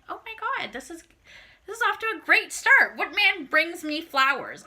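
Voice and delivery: high, thrilled squeal; gushing. Retold or imitated. To the listeners, gushing about the roses, high and excited. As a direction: gush over the flowers, loud. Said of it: "And I'm like".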